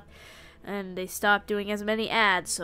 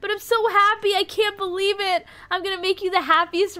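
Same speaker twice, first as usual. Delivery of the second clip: high, mock tearful gushing; crying from joy; 8 semitones higher than usual. Rat bride accepting the proposal, mock crying from joy. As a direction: play happy tears in a high voice. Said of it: "I'm crying from joy a little".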